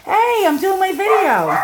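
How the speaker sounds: loud, high, annoyed shout; snapping at the interrupters; annoyed protest. Real, not acted. Family keeps interrupting her video.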